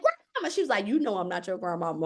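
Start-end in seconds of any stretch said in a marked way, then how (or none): 0.0-0.5 high, eager call of grandmama; calling out to her like a long-lost relative; joyful recognition; excited call. Retold or imitated. 0.8-2.0 lower, softer voice of the goddess; lightly voiced goddess; goddess replying. Goddess answers in story.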